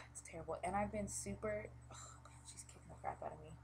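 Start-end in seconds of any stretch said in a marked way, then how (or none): 1.9-3.5 hushed aside under her breath; sudden whisper. Real, not acted. Telling viewers the baby is kicking her hard, in a whisper.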